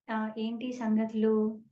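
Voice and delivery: drawn-out, low, breezy hello; slow casual greeting. Retold or imitated. Guru strolls back and greets her slowly and lightly.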